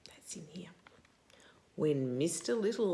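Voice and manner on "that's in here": soft unvoiced aside; whisper. Real, not acted. Reading to a child, whispering an aside. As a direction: lean in and whisper it.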